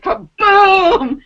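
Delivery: high, loud imitated thunderclap; booming thunder. Storyteller mimicking thunder in the storm, booming. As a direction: explode on the word like thunder.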